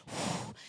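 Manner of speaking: a soft unvoiced puff of breath. Preacher miming God's breath to the congregation, soft puff. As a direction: blow out a quick whoosh.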